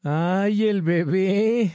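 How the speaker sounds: high, slow teasing "ay, el bebé"; mock him like a baby. A narrator's reading. Erika teasing Thor for calling his dad, high and mocking. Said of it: "dijo Erika".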